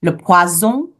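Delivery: "le poison" with a buzzing Z, pwa-ZON; Z sound. Shown as a demonstration. Teacher warns of lazy pronunciation, Z sound. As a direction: say French "poison" with Z in the middle. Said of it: "we pronounce it with a Z sound".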